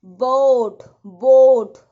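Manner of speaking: "बोट" loud with a long gliding au; long, rounded vowel in "बोट"; au sound. Shown as a demonstration. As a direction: stretch the vowel of "बोट" loudly, as in boat. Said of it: "औ का sound आएगा".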